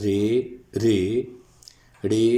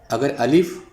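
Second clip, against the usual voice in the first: normal talking voice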